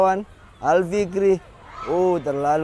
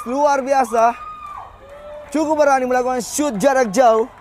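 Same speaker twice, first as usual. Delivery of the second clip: excited praise; 7 semitones higher than usual. Real, not acted. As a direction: gush over the goal. Praising the goal just scored.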